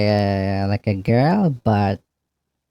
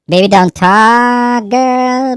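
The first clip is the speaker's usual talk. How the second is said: mock smooth-talker, higher and louder, slow; hammed-up flirty line; 9 semitones higher, 6 dB louder, slower than usual.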